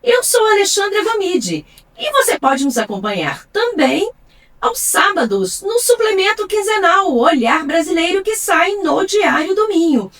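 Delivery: scripted programme promo, deliberately phrased; radio announcer. Acted. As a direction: present the show like a radio host. Host reads the programme announcement.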